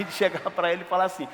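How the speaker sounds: ordinary delivery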